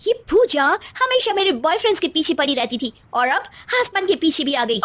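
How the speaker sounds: snide spoilt bride. Acted. Bride mocking her husband's sister, high and snide. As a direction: make fun of the name.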